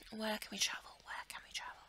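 unvoiced whispered question. Real, not acted. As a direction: whisper it to yourself.

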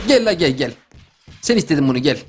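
deep threatening voice drawing the victim in; low sinister come here; low menacing taunt. Acted. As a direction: lure him over darkly. Menacing lure before throwing.

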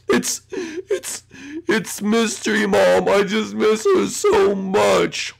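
slow, hammed-up sobbing; upset. Dad misses Mystery Mom, mock tearful voice. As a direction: stammer it out, then draw out the grief. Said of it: "he sounds upset".

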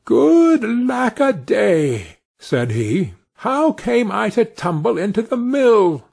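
high, slow startled cry; little Tom jolted awake in alarm; startled exclamation. Acted. Tiny Tom wakes in the cow's mouth and exclaims, high and drawn out.

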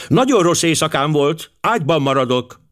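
high-pitched, firm wifely complaint; sharp, decided. A narrator's reading. Wife explaining to her husband why she stays in bed, sharp. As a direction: state it sharply, brooking no argument. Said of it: "A hang éles volt és határozott".

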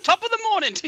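high-pitched mock Irish greeting; Irish accent. Suddenly seeing Jacksepticeye in the chat, hammed-up Irish accent. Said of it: "my best Irish accent".

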